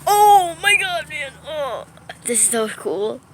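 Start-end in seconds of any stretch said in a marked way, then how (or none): 0.1-1.9 loud, high, drawn-out amazement; stretched-out shout of wonder; awestruck exclamation. Real, not acted. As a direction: cry out slowly in real awe. Another big flash interrupts.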